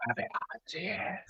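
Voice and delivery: exaggeratedly hushed, very soft voice; mock whisper. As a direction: whisper it as a joke after being told off. Obeys advice to just whisper.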